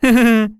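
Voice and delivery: high acted giggle; giggle, interrupting the story; laugh. A narrator's reading. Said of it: "засмеялась Нюшка". (высокий смешок)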